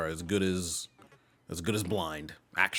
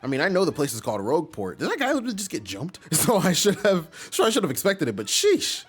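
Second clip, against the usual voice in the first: a hint of a higher-pitched, grossed-out student; lightly voiced appalled student; 6 semitones higher, 6 dB louder than usual. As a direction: complain lightly as a chatty, disgusted student. Voices disgusted Goombella.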